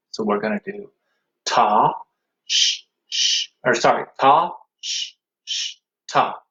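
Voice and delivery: ordinary talk, nothing marked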